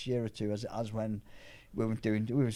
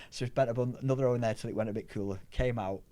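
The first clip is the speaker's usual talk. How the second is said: normal talking voice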